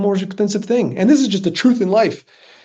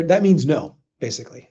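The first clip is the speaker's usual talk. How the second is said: normal talking voice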